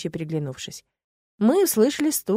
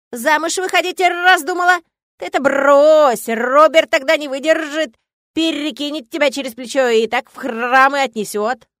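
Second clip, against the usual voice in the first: louder, higher cawing scold; scolding raven; 8 semitones higher, 9 dB louder than usual. A narrator's reading. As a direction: peck at her like a cheeky raven. Raven voiced.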